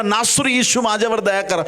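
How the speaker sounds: high, pressing call for mercy; crying out. Acted. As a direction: cry out so he hears you over the crowd. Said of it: "तो ओरडणार".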